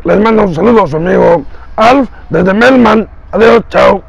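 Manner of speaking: high alien puppet voice; squeaky cartoon alien; Alf voice. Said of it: "Mi siguiente imitación es la voz de Alf". (voz de Alf aguda de títere)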